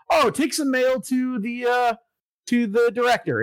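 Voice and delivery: high-pitched chipper instruction voice; mock cheery. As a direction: imitate a perky game prompt, pitched well up. Game cheerily hands out a task.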